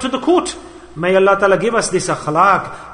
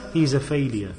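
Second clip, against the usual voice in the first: failure dropped low and softer; low, soft verdict; 6 semitones lower, 7 dB quieter than usual. Shown as a demonstration. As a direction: let the verdict sink quietly. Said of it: "What is that person?".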